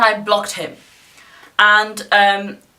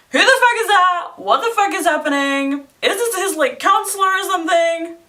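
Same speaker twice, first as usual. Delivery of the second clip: baffled disbelief; 9 semitones higher than usual. Retold or imitated. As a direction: squeak it out in total disbelief. Baffled outburst at a stranger.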